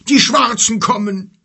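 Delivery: viel höher und lauter gebrüllt. A narrator's reading. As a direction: panisch warnend herausbrüllen. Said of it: "brüllte er". (Kelim warnt die Gruppe, gebrüllt)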